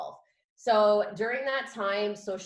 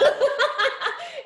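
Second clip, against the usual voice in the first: high burst of laughter; real laugh; 8 dB louder than usual. Real, not acted. Guest laughing at host's name joke, high laugh. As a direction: laugh freely.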